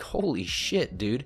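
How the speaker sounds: ordinary talk, nothing marked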